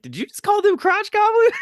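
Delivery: high incredulous squeal; shocked disbelief. Retold or imitated. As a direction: shriek it like you can't believe your ears. Comedian's shocked reaction retold.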